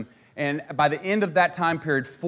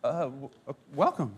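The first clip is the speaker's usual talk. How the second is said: softer, stumbling stammer; a timid, halting mock stammer; stammered welcome; 2 semitones lower, 6 dB quieter than usual. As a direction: fumble the word like a startled settler. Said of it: "can barely even mutter".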